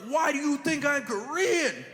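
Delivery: high, slow, baffled question. Retold or imitated. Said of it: "I was like".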